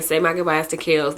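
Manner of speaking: normal talking voice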